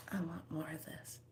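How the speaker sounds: soft unvoiced whisper; whispered wish. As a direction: breathe the wish out. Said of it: "you say".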